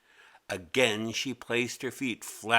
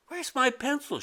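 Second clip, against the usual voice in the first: put-on much higher, puzzled little girl; 13 semitones higher than usual. A narrator's reading. Mimi hunting her desk for the lost pencil, high puzzled voice. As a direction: ask it like a confused kid. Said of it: "she said".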